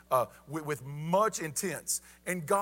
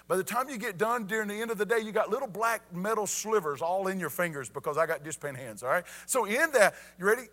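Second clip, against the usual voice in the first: usual speaking voice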